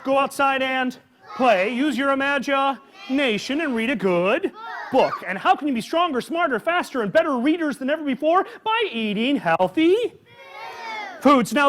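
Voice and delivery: plain reading voice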